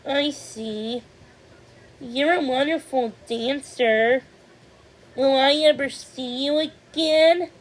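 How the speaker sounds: lightly voiced young girl, wistful; gentle girlish voice, a little sad; Thumbelina voice. Thumbelina voiced in read story.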